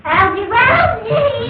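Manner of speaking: high, drawn-out cry of a name; wailing call. Acted. Woman calling Algy, wailing. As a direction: call out slowly in a high wail.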